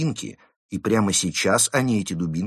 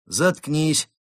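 higher-pitched command; curt order; 6 semitones higher than usual. A narrator's reading. Police moose silencing the wolf, ordering. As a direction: cut him off with an order. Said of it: "приказал один из лосей".

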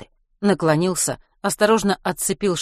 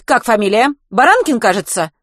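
put-on strict vice-principal catching boys outside; stern teacher; 6 semitones higher, 8 dB louder than usual. A narrator's reading. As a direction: interrogate like a strict head teacher.

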